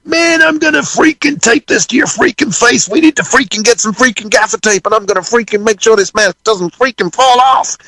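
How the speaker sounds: very high-pitched mock rant. Band's leader raging at drummer about the mask, freaking out. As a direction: imitate a bandmate losing it, voice way up high. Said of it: "he starts freaking out".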